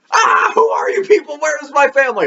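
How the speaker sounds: high-pitched frightened cry; hammed-up terrified kid wail; mock panic. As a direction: shriek in exaggerated alarm at strangers. Dende acted frightened among strangers.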